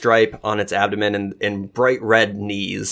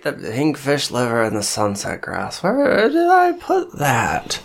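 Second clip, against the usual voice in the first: rising high as he searches; scatterbrained character voice, pitched up at the end; flustered crazy old-hand character; 3 semitones higher than usual. Acted.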